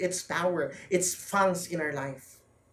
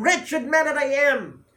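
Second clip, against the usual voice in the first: groaning; 8 semitones higher, 6 dB louder than usual. Acted. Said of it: "groaning within ourselves".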